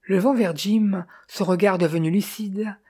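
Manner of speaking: usual speaking voice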